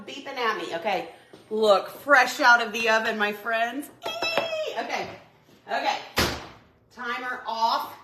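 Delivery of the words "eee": excited squeal. Real, not acted. Excited squeal at fresh dish.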